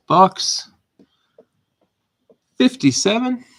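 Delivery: loud, high call of the box number; loud announcement. Real, not acted. Announcing the chosen box to the stream, loud and high. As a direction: shout out the box like a draw.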